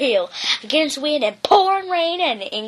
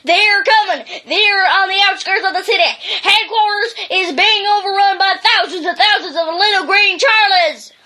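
raised, urgent higher alarm call; frantic sentry yelling battle stations; shouting the alarm; 6 semitones higher, 4 dB louder than usual. Acted. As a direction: sound the alarm, louder and higher. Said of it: "began shouting the alarm".

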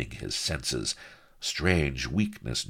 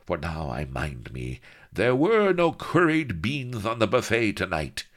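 croaked musing rising to high, loud outcry; ponder, then burst out in discovery; lightly croaked villain's deduction; croaking villain; 7 semitones higher, 4 dB louder than usual. A narrator's reading. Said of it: "he croaked".

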